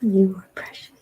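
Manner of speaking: tender soft whisper; whispering to cat. Real, not acted. Owner whispering tenderly to her cat. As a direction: murmur sweet nothings.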